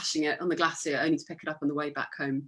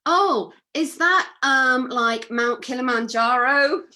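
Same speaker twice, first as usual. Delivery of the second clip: slow, loud, higher mock Canadian accent; hammed-up Canadian accent, guessing hesitantly; bad Canadian accent; 5 semitones higher, 7 dB louder, slower than usual. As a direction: badly imitate a puzzled Canadian, drawn out and raised. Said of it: "I can't do the Canadian accent".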